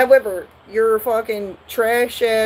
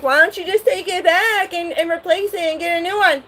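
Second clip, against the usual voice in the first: put-on high kid suggestion; 10 semitones higher than usual. Sibling suggests a replacement.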